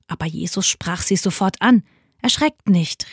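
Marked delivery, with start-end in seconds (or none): none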